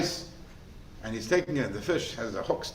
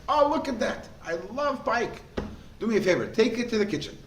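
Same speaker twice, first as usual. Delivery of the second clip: higher, pleased lordly voice, lightly done; delighted nobleman; 3 semitones higher than usual. Acted. Nobleman pleased with the pike, high and hearty. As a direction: play the Paritz delighted with his fish.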